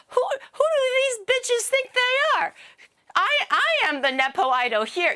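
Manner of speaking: campy catty diva voice; mock diva outrage. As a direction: sneer at the rivals like a spoiled star. Playing rival-hating nepo idol.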